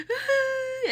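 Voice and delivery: high cry breaking into a laugh; laughing sob. Retold or imitated. Told to cry by sister, high laughing sob. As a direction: let out a laughing wail.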